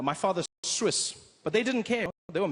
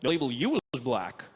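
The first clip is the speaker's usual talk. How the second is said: American man; 4 semitones lower than usual. Retold or imitated. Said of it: "he said to me".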